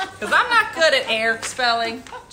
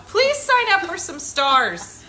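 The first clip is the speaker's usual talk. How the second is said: slow, raised-pitch appeal; mock pleading; 5 semitones higher, slower than usual. Host begging audience for stars, mock pleading. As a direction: plead in a drawn-out, higher voice.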